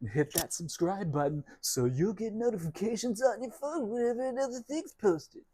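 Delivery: high, bright mock YouTuber pitch; mock YouTuber plea. Mocking other vlogger begging viewers, hammed-up pitch. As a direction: parody an eager channel host begging for subscribers. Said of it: "being like".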